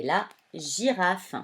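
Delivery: « girafe » lentement, début en « ji » allongé; G doux appuyé. Shown as a demonstration. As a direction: articule « girafe » avec un « j » bien net. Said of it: "vont la faire chanter J". (« girafe » dit lentement avec G doux)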